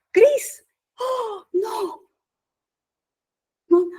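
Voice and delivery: high apologetic pastor. Retold or imitated. Pastor admits to Cris he forgot, high voice.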